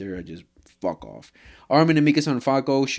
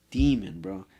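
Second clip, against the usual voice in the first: ordinary delivery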